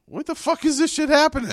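high, put-on disbelieving voice; incredulous outburst. Viewer looking out the window, high mock outrage. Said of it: "and it's like".

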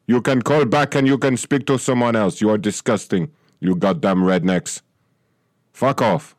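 outraged rant in a thick put-on accent; mock outrage, weird accent; indignant rant. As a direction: berate the caller in an odd foreign accent. Accented clerk berates caller as racist.

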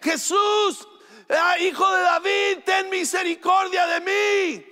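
very high, desperate shout for mercy; grito más fuerte. Retold or imitated. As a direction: shout it out to be heard over a crowd. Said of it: "empieza a gritar más fuerte". (grito desesperado, muy agudo)

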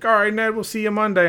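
high, loud voice imitating a departing kid; mock high-pitched farewell; athlete's breezy goodbye. Athlete saying goodbye on his way out.

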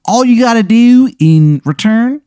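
high-pitched, hammed-up Baltimore accent; cartoonishly high Baltimore accent; terrible Baltimore accent. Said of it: "his terrible accent".

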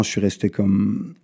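usual speaking voice

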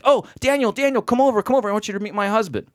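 high, gushing call across a room; mock-excited woman calling over; imitated excited hail. Christina calling him over.

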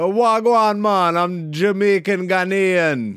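high, put-on Jamaican lilt; mock Jamaican accent. Host teases friend as Kofi Kingston, mock Jamaican accent. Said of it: "you did the Kofi Kingston real quick".

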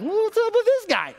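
very high-pitched incredulous squeal; alarmed question. Retold or imitated. Passenger asking his friend about the cop, high-pitched alarm.

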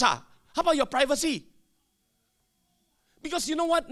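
ordinary delivery

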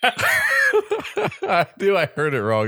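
high, laughing protest. Real, not acted. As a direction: defend yourself through laughter. Laughing at own mishearing.

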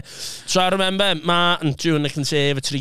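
ordinary delivery

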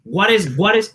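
put-on high Puerto Rican dad accent; dad's Spanish accent. Son demonstrating his dad checking on him, high Spanish accent. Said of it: "you would hear the accent".

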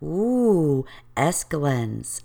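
put-on low, slow, spooked toddler word; imitating Phoebe. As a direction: draw the word out in mock fear. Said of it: "Phoebe was like".

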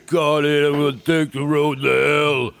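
mock Stallone mumble building to a shout; Stallone impression. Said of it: "Or he had Stallone doing it".

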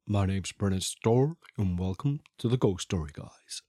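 low, soft impression of a podcast host; Brennan Storr. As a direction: drop low and quiet, imitating a cool-voiced host. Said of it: "I'm gonna do Brennan impression".

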